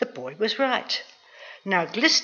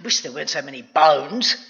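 low grumbling giant; grumpy ogre, pitched down; grumpy tones; 4 semitones lower than usual. A narrator's reading. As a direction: grumble about your meal. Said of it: "this in grumpy tones".

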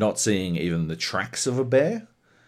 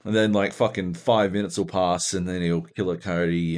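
normal talking voice

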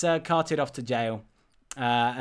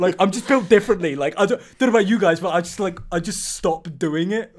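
mock Twitter bro; 4 semitones higher, 5 dB louder, faster than usual. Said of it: "like, shitty-ass Twitter bros".

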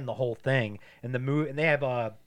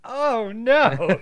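high, loud hammed-up dismay; mock dismay; 12 semitones higher, 13 dB louder than usual. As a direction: wail it like waking to disaster. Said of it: "he wakes up and he's like".

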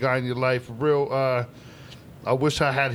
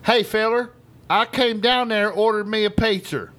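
country caller voice; 7 semitones higher, 4 dB louder than usual. Retold or imitated. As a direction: play the country customer complaining. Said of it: "Pizza, not pizza".

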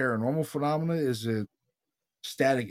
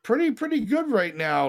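higher panicky retort; 9 semitones higher, 4 dB louder than usual. Retold or imitated. Retold reply to father in storm.

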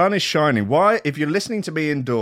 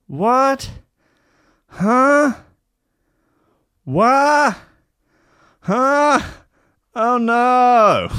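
high, loud baffled huh and what; confused shock; 10 semitones higher, 6 dB louder, much slower than usual. Real, not acted. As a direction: react in disbelief again and again. Confused by hacked Discord.

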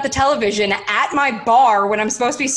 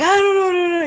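high, loud wail of helplessness; hammed-up distressed whine; mock freak-out; 12 semitones higher, 4 dB louder, faster than usual. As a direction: wail it high in a playful panic. Acting out being upset and frantic.

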